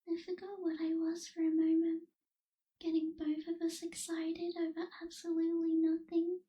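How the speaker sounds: ordinary delivery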